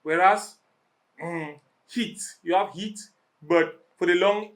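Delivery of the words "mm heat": ordinary talk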